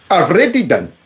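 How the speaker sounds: "already" as "avrety" in mock Indian accent; mimicked Indian accent. Host echoing congressman's "already done", mock Indian accent. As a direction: give "already" a v-sound and hard t, imitating an Indian accent. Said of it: "he was trying to say already".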